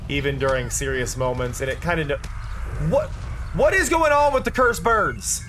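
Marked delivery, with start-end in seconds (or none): 2.9-5.4 suddenly confused by something odd; surprised. Real, not acted. Sudden puzzled outburst at screen.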